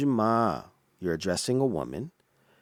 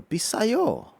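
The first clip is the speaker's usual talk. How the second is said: 비싸요 said much higher; 13 semitones higher, 3 dB louder, faster than usual. Shown as a demonstration. Teaching the polite word for expensive, high. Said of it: "to say expensive in Korean".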